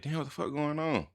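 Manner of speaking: high, bewildered retold outburst; confused exclamation. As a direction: someone who can't see why everyone went quiet. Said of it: "So I'm like".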